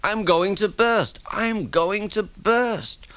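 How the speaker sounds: high, slow, strained cry of panic; an engine sure it will burst; panicked groan. A narrator's reading. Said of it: "groaned Thomas".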